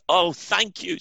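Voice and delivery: high, eager thanks; grateful and breathless with relief; relieved man. A narrator's reading. Dangling man thanks the voice, high and eager. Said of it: "said the man".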